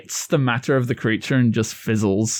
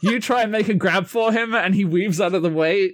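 ordinary talk

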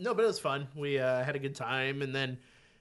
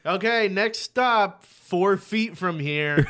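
slow, drawn-out stop announcement; mock a tediously slow conductor; train announcer imitation; 4 semitones higher, 5 dB louder, much slower than usual. Man mimicking train stop announcements, mock announcer.

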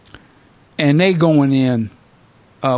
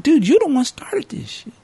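incredulous retort; 11 semitones higher than usual. Retold or imitated. His retort to the character.

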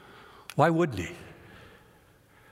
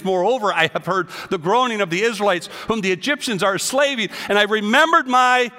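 preaching at full force; 4 semitones higher, 5 dB louder than usual. Acted. Preacher proclaiming God's words to his congregation, loud. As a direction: declare each phrase like thunder.

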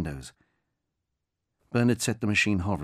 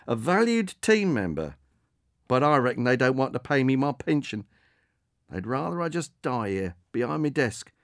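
higher, a touch bitter, lightly voiced; rueful old employee; 6 semitones higher, 4 dB louder than usual. Aging delivery man telling Margaret about his job, rueful. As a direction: complain wryly about the company.

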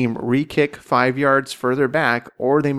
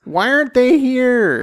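high whiny; 12 semitones higher, 7 dB louder, slower than usual. Pretend. Mock complaint about absent listeners.

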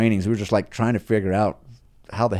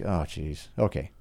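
quiet, grudging give-in; resigned mutter; 5 semitones lower, 7 dB quieter than usual. Retold or imitated. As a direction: sigh and give in under your breath. Grudging inner reply.

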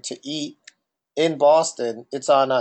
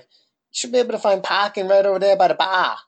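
higher-pitched, exaggerated Boston accent; 4 semitones higher than usual. Doorman giving parking directions, exaggerated Boston accent. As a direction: imitate a Boston doorman, long A's. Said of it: "This guy sounds weird".

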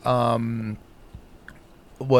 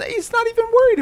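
squeaky mock voice; 4 dB louder, faster than usual. Real, not acted. Mocking the man's attitude.

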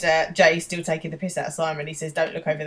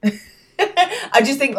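laughing; 8 semitones higher, 4 dB louder, much slower than usual. Real, not acted. Laughing while talking.

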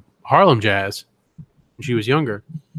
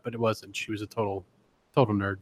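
normal talking voice

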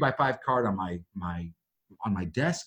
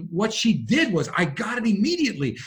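normal talking voice

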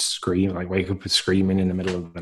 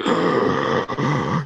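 gasp; 6 semitones higher than usual. Retold or imitated. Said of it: "And I'm instantly like".

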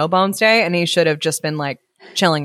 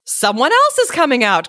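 higher-pitched eager announcement; excited cry; 7 semitones higher than usual. A narrator's reading. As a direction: call it out in storybook excitement. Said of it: "cried Fari".

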